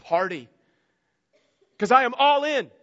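father's exultant cry, rising high and loud; joyful father. Acted. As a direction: throw the doors open, all in. Father welcoming prodigal son home.

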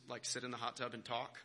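normal talking voice

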